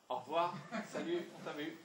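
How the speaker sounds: a quick offhand goodbye, done; dismissive goodbye. Shown as a demonstration. Caller brushing off the unwelcoming speaker, offhand. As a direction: wave them off and hang up. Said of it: "les gens disent".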